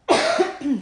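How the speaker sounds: harsh cough; a real coughing fit. Real, not acted. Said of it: "Sorry, guys, for the coughing".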